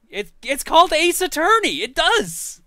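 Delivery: high, very loud insistence; real outburst, voice leaping way up; exasperated shouting. Real, not acted. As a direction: shout it as an indignant correction. Heated dispute over the game's title.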